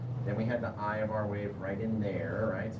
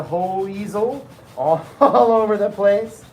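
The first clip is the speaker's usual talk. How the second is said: laughing loudly; 8 semitones higher, 11 dB louder than usual. Real, not acted. Laughing about shaking easel.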